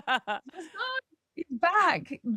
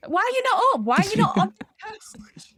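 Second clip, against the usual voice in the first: much higher and louder, alarmed; alarmed mum; 10 semitones higher, 6 dB louder than usual. Retold or imitated. Mother phoning her daughter who missed the coach, alarmed. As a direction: panic at her for sleeping in. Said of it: "she was like".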